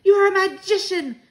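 loud, high exclamation; delighted shout. A narrator's reading. Grandmother praises the child's plan, shouting. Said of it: "my grandmother shouted".

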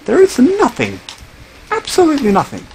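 high-pitched exasperated wail; squealed disbelief at finding nothing. Real, not acted. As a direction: pitch it way up in frustration. Fed up, cave is empty.

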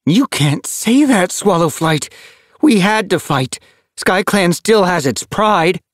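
put-on a young she-cat standing up for her clan; fierce she-cat. A narrator's reading. She-cat glares, argues back.

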